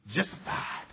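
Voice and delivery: breathy hushed whisper. Real, not acted. Preacher whispers the key word to his congregation, hushed. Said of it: "whispered recap of the word".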